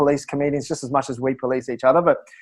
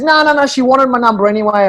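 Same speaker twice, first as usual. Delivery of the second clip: higher-pitched, insistent retold denial; playfully protesting, pitched up; mock protest; 6 semitones higher than usual. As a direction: imitate a friend brushing off the compliment. Retelling his friend's insistent brush-off.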